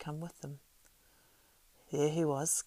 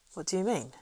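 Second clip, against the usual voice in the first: a sharp, suspicious question; 4 semitones higher, 5 dB louder than usual. A narrator's reading. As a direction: snap the question at him. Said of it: "asked Frodo sharply".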